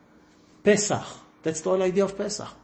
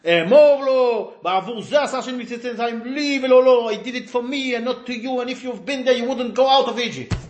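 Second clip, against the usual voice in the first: harsh telling-off; 8 semitones higher, 6 dB louder, faster than usual. Shown as a demonstration. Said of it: "how can you tell him off?".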